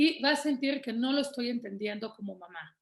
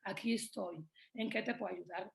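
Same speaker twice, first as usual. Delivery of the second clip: soft reassuring; 2 semitones lower, 10 dB quieter than usual. Shown as a demonstration. Mother hugging her tantrumming child, soft and reassuring. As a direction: comfort a crying child gently. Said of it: "le hablamos al oído".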